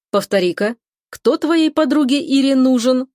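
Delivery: slow, disbelieving question; full of scepticism. A narrator's reading. Man asking his brother about the fiancé request, sceptical. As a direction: make him repeat something absurd. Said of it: "бросаю полным скепсиса голосом".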